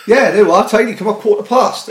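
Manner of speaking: high, loud and eager, mimicking his friend; pushy mate impression. Mocking his mate's keen invite, high and loud. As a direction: ham up the friend who won't take no. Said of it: "like the persistent twat, just said".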